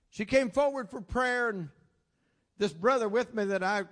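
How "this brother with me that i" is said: usual speaking voice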